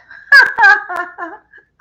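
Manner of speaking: a high laughing half-sob that breaks out; teary laugh. Real, not acted. Signing off to her viewers, a teary high laugh. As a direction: laugh with tears close behind.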